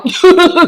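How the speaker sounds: sudden high, loud cackle; loud burst of laughter. Real, not acted. Host laughing at her prison pen pal joke, loud cackle. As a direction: crack up at your own joke.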